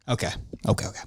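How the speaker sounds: whispered aside. Real, not acted. Host calming himself after his rant, whispered aside. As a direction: mutter it under your breath.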